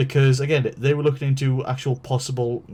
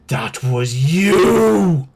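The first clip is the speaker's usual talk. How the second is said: loud, very slow accusation; accusing guard; 3 semitones higher, 8 dB louder, much slower than usual. Retold or imitated. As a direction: drag out each word, pointing the finger. Security guard glares and accuses.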